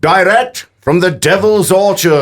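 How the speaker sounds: a grand radio-host intro; announcer billing. Acted. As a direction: sell it like a radio promo. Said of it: "announcer-style billing".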